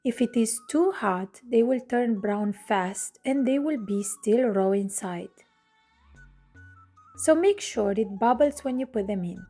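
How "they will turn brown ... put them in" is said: ordinary delivery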